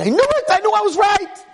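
high, loud hysterical shriek; hammed-up panicked wail; screaming. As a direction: scream in horrified vindication.

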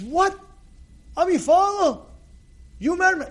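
high-pitched, astonished questions; each question jumps up, stunned; shocked disbelief. Acted. Father-in-law learning who the rabbi is, shocked. Acted shock at son-in-law's news.

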